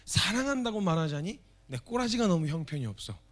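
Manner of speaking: ordinary delivery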